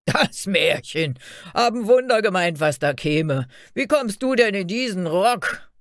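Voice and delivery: loud mockery, pitch leaping up; jeering shouts. A narrator's reading. Guards make fun of Fairy Tale's dress, jeering shouts. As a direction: scoff loudly as a guard deriding her. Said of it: "riefen die Wächter und lachten aus vollem Hals".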